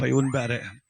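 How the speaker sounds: normal talking voice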